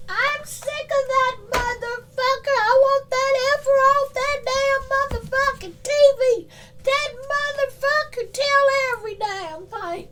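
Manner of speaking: high put-on voice, fed up; high mock rant. Voicing fed-up message.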